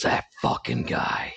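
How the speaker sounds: slow, voiceless mock grumble; retold contempt, drawn out without voice; imitated disgust. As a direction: mimic a dad cursing someone under his breath. Dad's private disgust, retold.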